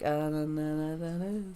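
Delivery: slurred, droning I-don't-know hum; imitated clueless mumble. Telling friend about checking the cameras, mock clueless drone. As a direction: mumble it on one note, rising at the end. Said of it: "I'm like".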